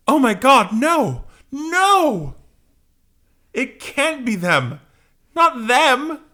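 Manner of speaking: hammed-up, high panicked cries; horrified disbelief. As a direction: wail in comic-book horror, drawing it out. Scott sees the Sentinels.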